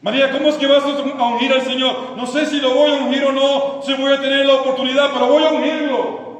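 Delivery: loud, high question and defiant answer; ringing, insistent resolve; impassioned determination. Acted. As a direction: preach it at full force. Repeats challenge and Mary's answer.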